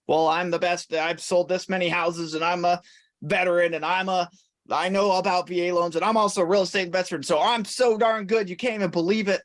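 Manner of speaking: imitating a self-promoting salesperson, pitch climbing; boastful agent. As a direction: rattle off credentials like a show-off.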